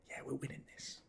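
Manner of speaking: very soft, whispered self-assurance; hushed retold inner thought; whispered confidence. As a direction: whisper it as if privately sure. Retelling his quiet confidence during match.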